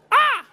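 high, loud exclaimed "Ah!"; mock outcry. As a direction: cry out in exaggerated protest. Re-enacts reaction to the handshake.

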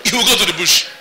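the preacher laughing at his own punchline; laughing repeat. Real, not acted.

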